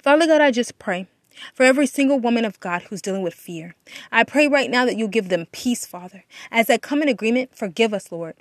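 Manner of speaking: normal talking voice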